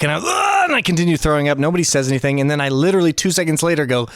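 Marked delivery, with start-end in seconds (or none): 0.2-0.6 very high straining scream; Vegeta heave scream. Retold or imitated. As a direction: scream out a heave like a powering-up warrior. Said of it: "I'm going full Vegeta".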